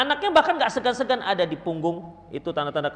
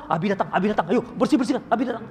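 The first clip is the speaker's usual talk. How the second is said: mock panicked warning, laughing; panicked kids; 2 semitones higher, 4 dB louder, faster than usual. Children warning each other as father arrives, mock panic. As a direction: hurry the others, half-laughing alarm.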